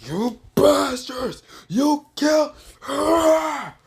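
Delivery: high, slow, strained cry of grief and rage; anguished furious cry. Acted. Naruto screaming at the enemy over her death, high and drawn out. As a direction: howl it out like he just lost her. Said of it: "where Naruto says".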